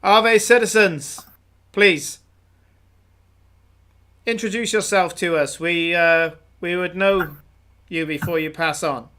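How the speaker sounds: a self-important soldier greeting travellers; puffed-up guard welcoming travellers; officious guard. Acted. GM voices guard NPC.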